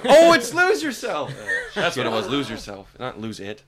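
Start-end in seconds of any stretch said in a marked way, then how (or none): 0.0-1.3 high, loud burst with laughter; excited recognition. Acted. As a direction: blurt it out, thrilled to recognize it. Acts sudden recognition of the song.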